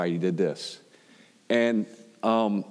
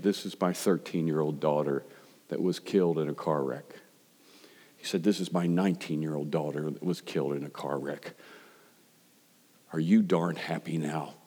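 low and soft, heavy with grief; grieving man; 5 semitones lower, 4 dB quieter than usual. Retold or imitated. A grieving father tells the pastor about his daughter, low and soft. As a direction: let the loss weigh down every word.

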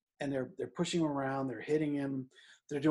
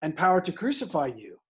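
louder and higher, a bullying taunt; Pilate's taunt; 4 semitones higher, 6 dB louder than usual. Retold or imitated. Pilate loudly taunting a silent Jesus, indignant. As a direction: throw your power at the prisoner. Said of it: "Pilate says".

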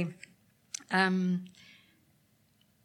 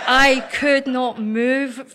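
real laughter through the words, slow and louder; laughing; 3 semitones higher, 6 dB louder, much slower than usual. Real, not acted. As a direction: laugh while saying it. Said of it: "I went into a fit of laughing".

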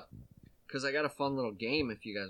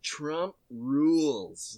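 slow mock reading; 4 dB louder, much slower than usual. Speaker to friends reading a shirt, slow mock reading. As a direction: slowly spell out the shirt. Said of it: "it just said".

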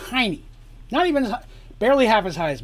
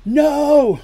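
loud, high, drawn-out scream of protest; 11 semitones higher, 12 dB louder than usual. A narrator's reading. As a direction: scream it out as a desperate mother. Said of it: "screamed Dandy".

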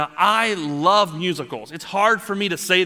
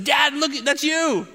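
put-on higher, slow little-girl voice; imitating little daughter; 10 semitones higher, slower than usual. Dad imitating his toddler daughter at the TV, high little voice. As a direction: mimic a toddler pointing at the screen. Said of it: "but she said".

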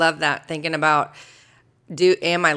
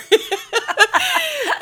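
high, loud squeal of laughter; 5 dB louder than usual. Real, not acted. Co-host after mimicking the pastor's accent, laughing hard. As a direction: crack up at your own impression.

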